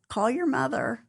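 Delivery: playful mock nagging; pesky mom. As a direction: lightly imitate a pestering mother. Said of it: "one of those pesky moms".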